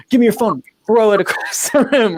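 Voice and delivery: high, loud pretend order; hammed-up outburst, laugh breaking in; mock forceful demand. Stylist pretending to force a client, mock high-pitched yell.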